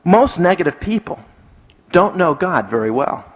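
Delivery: weighty point split by a pause; emphatic statement. Shown as a demonstration. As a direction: set "Most negative people" apart before "don't know God very well". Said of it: "Three times for emphasis".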